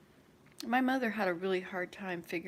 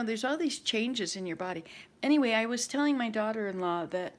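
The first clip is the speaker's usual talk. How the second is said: ordinary talk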